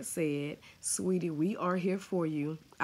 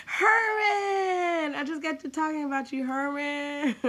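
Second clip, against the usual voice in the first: high laughing shout across room; calling out; 11 semitones higher, 7 dB louder than usual. Real, not acted. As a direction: shout his name through laughter. Calls out to husband, laughing.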